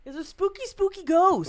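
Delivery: high, loud spooky ghost voice. Pretend. Joking about spooky ectoplasm.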